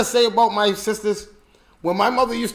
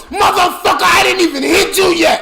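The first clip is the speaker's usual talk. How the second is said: higher, louder mock yell; angry mother; 8 semitones higher, 5 dB louder than usual. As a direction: bark it like a furious mom with a belt. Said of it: "she used to go".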